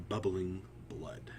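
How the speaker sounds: plain reading voice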